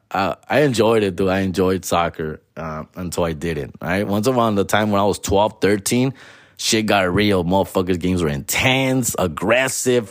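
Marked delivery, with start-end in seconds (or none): none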